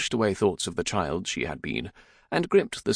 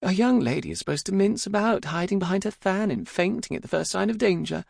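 high, pert daughter voice; high-pitched mock recital of a rule; 13 semitones higher than usual. A narrator's reading. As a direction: cut in pertly on Papa's lecture, pitched well up. Daughter cuts in, parroting his lecture.

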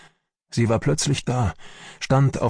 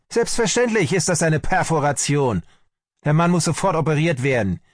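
higher-pitched, emphatic insistence; shouted retort; 8 semitones higher than usual. A narrator's reading. Army doctor demands an immediate operation, shouted retort. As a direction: insist angrily on urgent action. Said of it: "schrie mich Sellnow an".

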